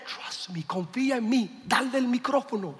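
soft, confidential reassurance; hushed retold aside. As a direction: drop to a near-whisper, then soft Spanish.

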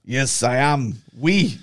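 mock French accent on the footballer's reply. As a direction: put on a light French accent. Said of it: "French, that's why".

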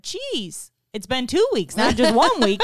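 high, startled "Jeez", laughing by the end; surprised, laughing into it; amazed exclamation. Retold or imitated. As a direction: act floored that it's been two weeks. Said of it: "And I said".

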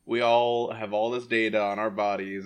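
ordinary delivery

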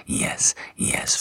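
usual speaking voice

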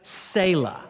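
"Selah" said as SAY-la; long ay in the first syllable of "Selah"; variant pronunciation. Shown as a demonstration. Showing how some pronounce the word, "Selah" as SAY-la. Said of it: "Some pronounce it".

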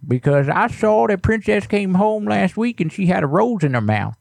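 put-on creaky old neighbor, fussy and nosy; old man voice. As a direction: play a suspicious grumpy grandpa. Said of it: "anytime I use that old voice".

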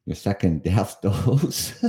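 breaking into real laughter mid-phrase; can't get through it without laughing; giggling out the words, slowed. Real, not acted. Laughing while reading a comment.

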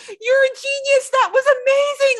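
high, loud gushing praise; gushing fans. Retold or imitated. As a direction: squeal it like an adoring fan. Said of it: "They were like".

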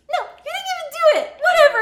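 high, fast, loud whiny brush-off; mock her snippy dismissal; imitating her sister's snippy comeback. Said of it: "And she was like".

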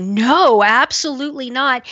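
firm, punchy refusal with a high lift on absolutely; emphatic no. Retold or imitated. Host quoting a guest's emphatic refusal, emphatic. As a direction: stamp the no down hard. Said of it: "he says it very, very emphatically".

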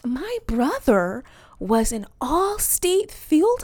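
high, slow, polite fibbing; fake interview voice. Job candidate inventing a brother to please interviewer, falsely chirpy. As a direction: put on an airy interviewee voice. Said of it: "I'll be like".

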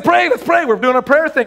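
high, hurried call to pray; excited urging. Retold or imitated. Retold urging man to pray.

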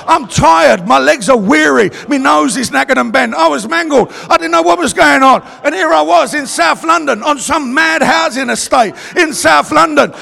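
loud, driving preacher voice; full-force testimony. Real, not acted. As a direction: preach your story at full volume.

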